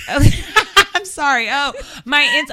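slow, laughing apology; breaking up with laughter, trying to recover; giggling through the plug. Real, not acted. Cracks up mid plug.

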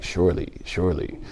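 low, soft solemn mock reading; a hint of stilted scripture reading; mock translation voice. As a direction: parody the stiff old translation. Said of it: "you read the whole, like".

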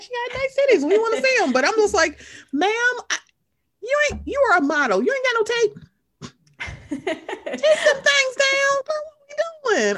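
put-on high-pitched sassy girlfriend voice, scolding with a laugh; mock-scold her outfit in a squealy high voice. Podcaster scolding a reality star's outfit in a high sassy voice. Addressing Cynthia in a podcast recap.

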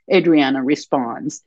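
usual speaking voice

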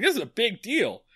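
high, excited mock panic; Barney freaking out over a shipment, high and excited. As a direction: squeak it like a nervous deputy. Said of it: "Barney starts freaking out".

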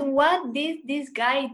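ordinary talk, nothing marked

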